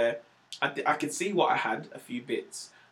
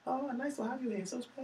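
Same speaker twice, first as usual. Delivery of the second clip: very soft, high Chinese-accented voice; gentle, true-to-life Chinese accent; realistic Chinese accent; 9 semitones higher, 11 dB quieter than usual. Shown as a demonstration. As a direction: imitate a polite Chinese takeaway host, quietly. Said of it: "'Cause that's how she talks".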